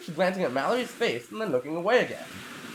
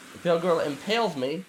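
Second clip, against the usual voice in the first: ordinary talk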